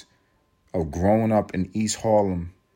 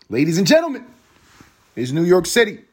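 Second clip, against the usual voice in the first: announcer voice; 9 semitones higher, 6 dB louder, faster than usual. Pretend. Announcing New York like a host.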